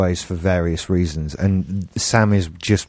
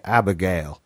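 southern accent; 3 semitones lower than usual. Shown as a demonstration. The only way they could hear the name, southern accent. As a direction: put on a southern US voice for Abigail. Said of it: "in that, in that southern voice".

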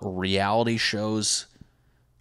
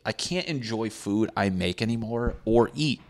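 normal talking voice

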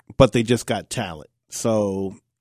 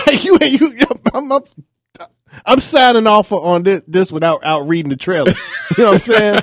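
laughing through the words; chuckling as he talks; 7 semitones higher, 4 dB louder than usual. Real, not acted. Laughing while retelling a joke.

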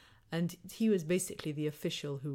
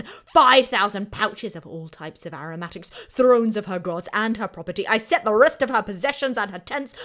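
loud, boastful proclamation of a conquering king; Assyrian king impersonation; 2 semitones higher, 11 dB louder than usual. Acted. Historian reads a royal war inscription as the king, booming Assyrian king. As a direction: declaim each spoil at full voice, building to a huge finish. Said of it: "now I'll do my best Assyrian impersonation".

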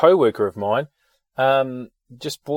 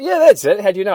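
higher, louder excited recognition; 9 semitones higher, 7 dB louder, faster than usual. Retold or imitated. Kiwi bloke amazed his chippy is known, excited higher and louder. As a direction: jump on it, thrilled he knows.

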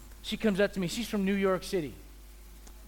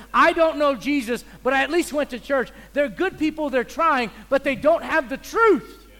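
loud, higher preaching at full force; fiery preaching; 7 semitones higher, 9 dB louder than usual. Acted. Pastor fires up the congregation about empty ritual, loud preaching. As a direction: pound the pulpit, voice raised high.

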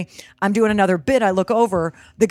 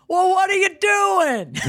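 mock angry heckler; 10 semitones higher, slower than usual. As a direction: bark it like an annoyed heckler. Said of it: "this one guy just got mad".

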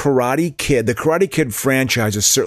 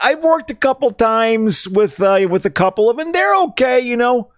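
higher put-on Chicago accent; 10 semitones higher than usual. Crediting his co-host by slipping into a high Chicago accent. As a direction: lay on a thick Chicago accent, much higher. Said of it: "it has changed into a Chicago accent".

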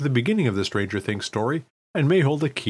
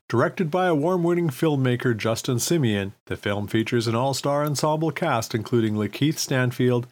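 usual speaking voice

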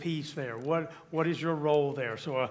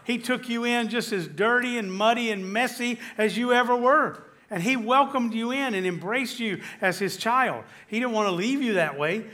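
high, loud, impassioned sermon climax; full-force preaching; 6 semitones higher, 4 dB louder than usual. Acted. Pastor exults over God's welcome to sinners, impassioned and loud. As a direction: lift the room with God's welcome.

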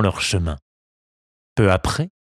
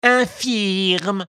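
echoing cat; 10 semitones higher than usual. A narrator's reading. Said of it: "répéta le chat".

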